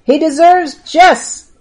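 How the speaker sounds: high, loud, slow cry of condemnation; crowd's outcry. A narrator's reading. Rabbis answering the high priest, crying out their verdict. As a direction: call for death as a mob would, drawn out. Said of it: "cried the rabbis".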